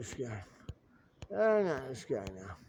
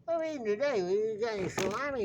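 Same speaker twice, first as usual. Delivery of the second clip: bird voice; 7 semitones higher than usual. Acted. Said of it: "he said".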